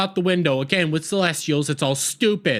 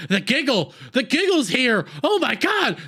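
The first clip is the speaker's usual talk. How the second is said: mock panic; 7 semitones higher than usual. Doctor's panic at the giggle.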